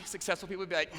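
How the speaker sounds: ordinary talk, nothing marked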